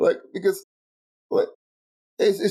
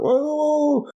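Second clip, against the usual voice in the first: mocking imitation. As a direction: squeal it like a startled bloke. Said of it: "He's like".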